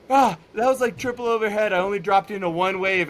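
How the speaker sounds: imitating a friend's exclaimed admission; retold outburst. Quotes Dave admitting the waves were huge.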